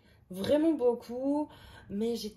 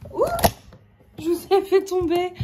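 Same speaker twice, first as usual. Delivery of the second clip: high, loud startled exclamation; real yelp of surprise, much louder and higher; startled cry; 9 semitones higher, 12 dB louder than usual. Real, not acted. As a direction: cry out at a sudden mishap. Camera falls, she cries out.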